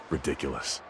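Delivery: short mocking laugh, then a soft dismissive word; scoffing chuckle at the idea; scornful laugh. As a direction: laugh it off as absurd. Guide scoffs at the client.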